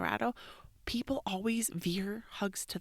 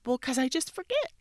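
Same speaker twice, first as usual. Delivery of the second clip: daughter's excuse; 7 semitones higher, 5 dB louder than usual. Retold or imitated. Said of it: "And she said".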